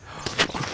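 startled gasp; sudden gasp of alarm; sharp gasp. Real, not acted. Spotted by enemy sniper.

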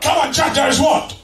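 forceful shouted prompt; rousing pulpit call; preacher's call for response. Real, not acted. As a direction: demand the answer from the congregation.

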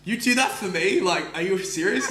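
high, loud incredulous questions; grateful disbelief. Retold or imitated. To bartender making free pizza, high loud disbelief. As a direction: ask it like you can't believe it.

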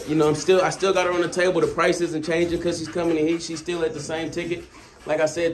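usual speaking voice

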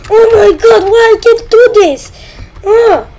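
high, loud wail of frustration; crying shout. Real, not acted. Gamer failing again cries out, high loud wail. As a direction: let it burst out near tears.

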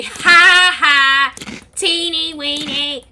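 drawn-out gloating tease; mocking taunt. Player teasing Junior back skinny, mocking taunt. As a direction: tease with slow, spaced syllables.

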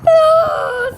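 high, loud cry of relief; excited cry. Real, not acted. Gamer finally succeeds, loud high cry of relief. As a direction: yelp as it finally works.

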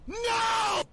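anguished shout. Real, not acted. Missed catch in game.

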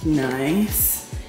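normal talking voice

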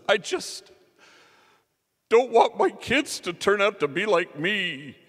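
high, loud, strained as if the voice might crack; trembling confession. Retold or imitated. Mario answering the woman, high straining voice. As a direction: push the painful truth out against fear. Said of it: "he's just trembling".

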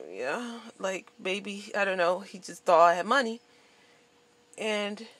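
ordinary delivery

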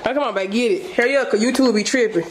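normal talking voice

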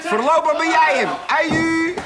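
high, loud mocking farewell; cheeky taunt. Tramp teases the policeman he is it, high and loud. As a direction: crow it at him as he leaves.

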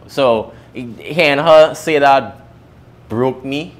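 ordinary talk, nothing marked

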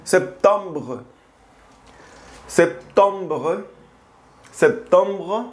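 septembre said sep-tahm-bruh; French pronunciation. Shown as a demonstration. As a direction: septembre with a nasal middle. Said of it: "para decir septiembre, decimos".

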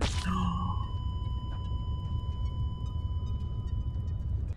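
very high drawn-out shriek of shock; shrill long gasp. Real, not acted. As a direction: let out a long squealing gasp. Dies again in the game.

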